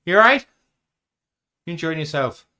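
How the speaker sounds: high, hammed-up Irish accent. Host checks on co-host in a mock Irish accent. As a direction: tease them in a put-on Irish voice.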